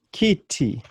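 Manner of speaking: "kitty" with ee vowels, kee-tee; Swahili i vowel. Shown as a demonstration. Teacher modelling Swahili word for chair, ee sounds.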